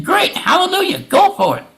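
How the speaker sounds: high sarcastic hallelujah; mock cheer. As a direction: throw it up high, mockingly.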